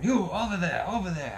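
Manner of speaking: high-pitched, loud police order; commanding cop. Retold or imitated. Cop pointing a rider off the road, imitated high commanding shout. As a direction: call it out like a cop directing a rider. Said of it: "and he's going".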